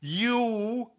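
high, eager guessing voice, very drawn out on the country; a wife sure she's got it. Retold or imitated. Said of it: "And my wife went".

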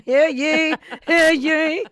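loud, high "hear ye" proclamation; town crier. Acted.

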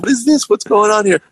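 high-pitched, mildly indignant questioning; lightly voiced angry manager. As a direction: mimic a manager demanding an explanation. Manager confronts him, retold.

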